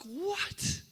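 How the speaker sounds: high, stunned disbelieving what; a sharp high cry of shock. Retold or imitated. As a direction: blurt it out in sudden disbelief. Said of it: "I'm like".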